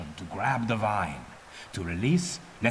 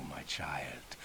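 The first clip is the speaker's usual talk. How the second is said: hushed, slow whisper, leaning in close; soft unvoiced whisper of a sly old teacher; conspiratorial whisper; 8 semitones lower, 14 dB quieter than usual. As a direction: whisper it like a secret between mentor and pupil. Mentor whispering to pupil.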